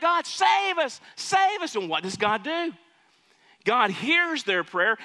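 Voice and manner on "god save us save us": pleading cry pitched way up; crying out to God. Retold or imitated. Person in trouble calls on God, desperate high plea. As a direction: beg for help like you're desperate. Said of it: "And they're crying out".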